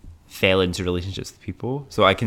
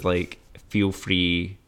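ordinary delivery

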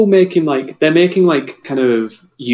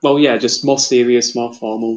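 ordinary delivery

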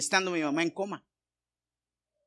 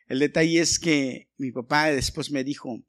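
normal talking voice

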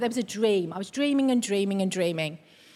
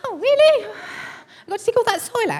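high-pitched, incredulous echo of her reaction; retold disbelief; 12 semitones higher, much faster than usual. Wife reacting to husband about digging out soil, high incredulous protest. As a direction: squeal it as mock dismay. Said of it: "So I said".

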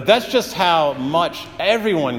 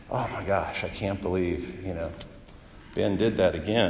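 weary, heavy-sighing disappointment, much softer and lower; exasperated sigh; 7 semitones lower, 9 dB quieter than usual. Pretend. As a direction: sigh it out like a let-down parent. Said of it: "shaking his head slowly and just sighing heavily".

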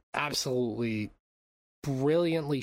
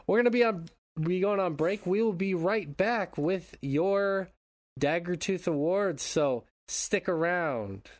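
radio announcer; 7 semitones higher than usual. Acted. Announces break radio-style.

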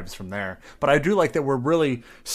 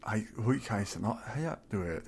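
mock mumbly Russian; 2 semitones lower, 9 dB quieter than usual. Imitating the sound of Russian for a co-host, soft mumbling. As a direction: mumble it softly like fake Russian. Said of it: "Russian language is very mumbly".

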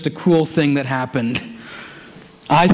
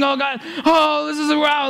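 high, breathless awe; overwhelmed gasp; 11 semitones higher than usual. Retold or imitated. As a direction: Peter too stunned to finish. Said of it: "he's freaking out".

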